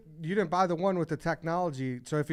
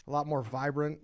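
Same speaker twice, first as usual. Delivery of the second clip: ordinary delivery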